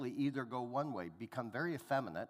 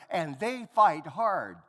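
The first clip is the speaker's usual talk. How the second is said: much louder and slow, leaning on each word; loud slow insistence; 4 semitones higher, 11 dB louder, slower than usual. Retold or imitated. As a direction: insist on it at full volume, slowly. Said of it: "says the girl".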